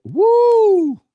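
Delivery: long, high, loud "woo"; hyped-up holler; excited whoop. Real, not acted. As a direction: let out a sustained high whoop. Spontaneous whoop at a point.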